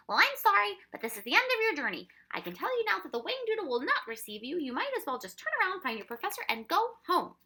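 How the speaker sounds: high, loud, businesslike official; brisk dismissal. A narrator's reading. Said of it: "he said briskly".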